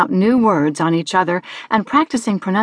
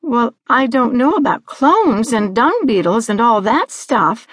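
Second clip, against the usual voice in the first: put-on girlish, pitched-up reply; Annalise; 3 semitones higher than usual. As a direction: protest innocently with a swooping pitch. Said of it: "Annalise replied".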